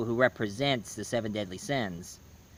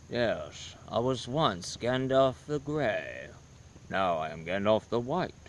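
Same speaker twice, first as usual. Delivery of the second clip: put-on a deep, grave old wizard intoning his lines; Gandalf; 4 semitones lower, 5 dB quieter than usual. Impersonating Gandalf quote.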